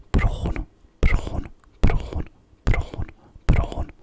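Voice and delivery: high, bad South African accent. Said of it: "in a South African accent".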